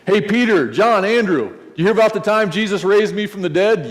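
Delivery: a man who can't stop telling his favourite story; eager storyteller. Retold or imitated. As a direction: Lazarus buttonholing his friends again, eager and loud. Lazarus retelling his story eagerly.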